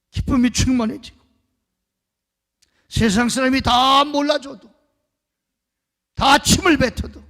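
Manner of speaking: loud, ringing sermon bursts; fiery Korean pulpit preaching; preaching at full force. Acted. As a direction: thunder each phrase at the congregation.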